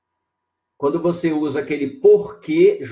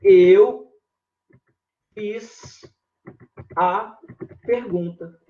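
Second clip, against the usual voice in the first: Eu, fiz, a, pergunta, each word set apart by a pause; slow word by word demo; 4 semitones higher, 3 dB louder, much slower than usual. Shown as a demonstration. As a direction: read Eu fiz a pergunta one word at a time. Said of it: "Observem a frase sem esse que aí".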